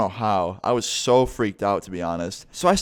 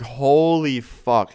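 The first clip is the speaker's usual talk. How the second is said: drawn-out, higher-pitched shock; stunned exclamation; 5 semitones higher, 4 dB louder, much slower than usual. Retold or imitated. Boyfriend hearing about the slashed tires, stunned exclamation. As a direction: stretch it out slowly in retold disbelief.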